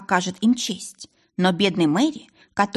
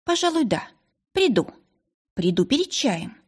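hesitant; 6 semitones higher than usual. A narrator's reading. Margaret agrees to visit the dead girl, mildly hesitant. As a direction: agree haltingly, with short pauses. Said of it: "потом неуверенно ответила".

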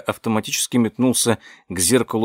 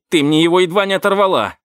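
pained yell; 7 semitones higher, 4 dB louder, faster than usual. Acted. Derek cries out in pain.

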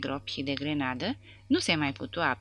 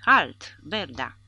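sharp sentry shout into the night; shouted halt; 8 dB louder, much slower than usual. A narrator's reading. Sentry shouting at soldiers in the dark, shouted. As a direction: call out like a guard. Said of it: "o voce strigă în noapte".

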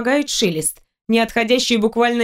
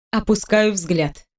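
ordinary delivery

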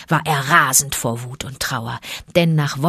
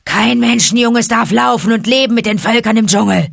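Shere Khan howls the demand, louder and higher; a tiger's raised howling demand; 5 semitones higher, 6 dB louder than usual. A narrator's reading. Shere Khan howling at the wolf pack, raised and loud. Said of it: "heulte Shere Khan".

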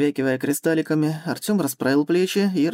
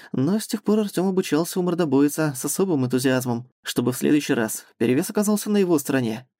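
normal talking voice

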